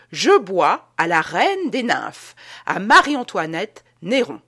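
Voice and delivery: loud slow toast; cried out toast. A narrator's reading. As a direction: raise the cup and cry it out slowly. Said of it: "Il cria".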